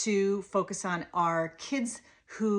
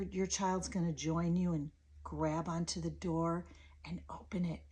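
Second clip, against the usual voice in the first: ordinary delivery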